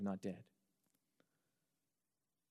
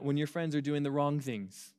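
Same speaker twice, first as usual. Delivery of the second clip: ordinary delivery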